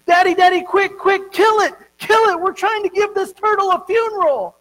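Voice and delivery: put-on high, loud, eager child's cry; excited little boy. Little boy shouting excitedly to his daddy at the funeral. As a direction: shout it like a thrilled kid rushing dad.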